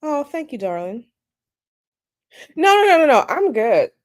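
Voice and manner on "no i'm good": ordinary talk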